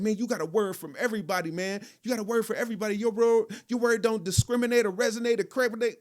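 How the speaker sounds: high, flowing impression of a friend; Terrence. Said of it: "He would just be flowing".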